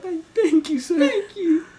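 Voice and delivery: very high, timid little voice; meek thanks. Retold or imitated. Worker thanking the customer, meek and squeaky.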